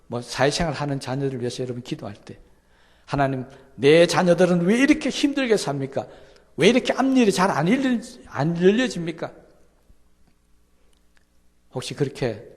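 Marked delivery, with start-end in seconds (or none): 3.0-9.3 loud, high, aggrieved cry to God; complaining prayer. Retold or imitated. Parent crying out to God in complaint, loud and high. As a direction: protest to God like a hurt parent.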